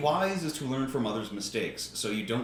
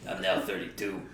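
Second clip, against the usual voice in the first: smoker voice; 6 semitones lower, 4 dB quieter than usual. Acted. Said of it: "That's a really good smoker voice".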